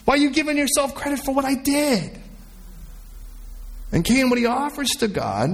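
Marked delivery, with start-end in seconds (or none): 0.1-2.2 high-pitched, insistent voice of God; God's rebuke. Acted. God scolds someone taking credit for His work, indignant rebuke. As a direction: press the point in a raised pitch.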